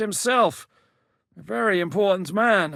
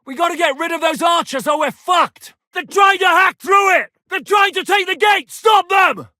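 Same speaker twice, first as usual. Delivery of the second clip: much higher and louder, frantic orders; desperate shouting; 8 semitones higher, 5 dB louder than usual. Acted. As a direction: scream over the siege. Defending the gate in battle.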